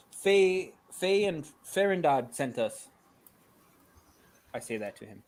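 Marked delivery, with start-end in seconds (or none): 0.1-2.9 high, loud call; loud, high in-character shout. Acted. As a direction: call up loudly to someone far off. Calls up to Timmy in character.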